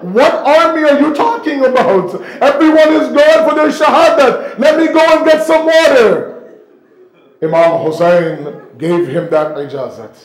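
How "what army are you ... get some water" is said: higher and louder, pushing hard; impassioned plea. Acted. Abbas pleading with the Imam to go, urgent loud plea. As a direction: beg with rising urgency.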